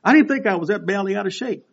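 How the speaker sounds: usual speaking voice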